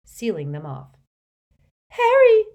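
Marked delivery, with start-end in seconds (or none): 1.9-2.5 very high, loud squeaked name. A narrator's reading. Hermione alarmed in the atrium, squeaking. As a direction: squeak out his name in panic. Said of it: "squeaked Hermione".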